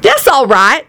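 high, lightly voiced cheerful reply; happy. A narrator's reading. Lucretia accepting the undressing problem, happy. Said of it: "Lucretia said happily".

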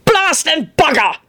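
high, drawn-out exclamation; shouted curse. A narrator's reading. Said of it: "Silas Scunthorpe shouted".

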